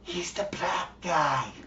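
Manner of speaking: slow, hushed stage whisper; mock whisper. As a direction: whisper it secretively in someone's ear. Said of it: "whisper in his ear".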